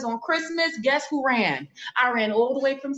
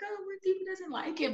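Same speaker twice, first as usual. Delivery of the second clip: timid worry; 10 semitones higher, 6 dB quieter, faster than usual. Retold or imitated. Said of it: "timid about my voice that I'm like".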